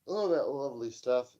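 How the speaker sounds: words stretched through a yawn. Real, not acted. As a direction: yawn while saying it. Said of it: "Sorry for yawning".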